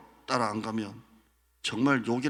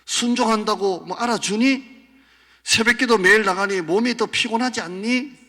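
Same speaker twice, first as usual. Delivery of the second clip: taunting devil; 9 semitones higher, 10 dB louder than usual. Acted. Devil taunting a believer about obedience. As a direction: hammer every doubt home.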